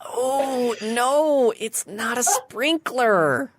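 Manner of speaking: high, drawn-out sympathetic letdown; perky sphinx. Acted. Sphinx rejecting the sprinkler answer, perky commiseration. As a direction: let them down sweetly in a high, stretched voice. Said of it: "goes back to a perky self".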